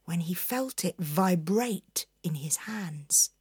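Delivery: hushed suspense whisper. A narrator's reading. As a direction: whisper it like a secret.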